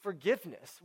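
ordinary talk

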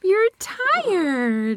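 high singsong tease; teasing high voice. Pretend.

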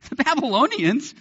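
put-on "The Babylonians?" with a laugh; laughing disbelief. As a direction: laugh it off as absurd. Repeated through a laugh.

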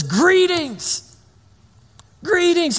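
greeting burst out high, as a startling angel. Retold or imitated. Angel bursting in on Mary, high excited greeting. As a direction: angel bursts in with a sudden hello. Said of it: "opts not for the normal angel introduction".

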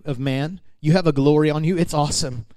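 normal talking voice

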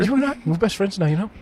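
high Antipodean badger voice; Australian or New Zealand accent. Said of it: "I want Australian and or New Zealand".

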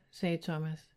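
ordinary delivery

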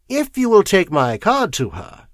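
plain reading voice

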